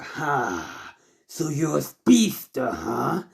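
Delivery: lightly voiced taunting villain; a hint of a smug villain. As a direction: size up a rival with a mocking "ha".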